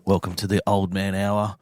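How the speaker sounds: mock soothing host. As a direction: lull the old men into relaxing. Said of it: "I'm just gonna introduce this section".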